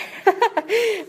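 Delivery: a sudden high laugh; high burst of real laughter. Real, not acted. Reader laughs at the text.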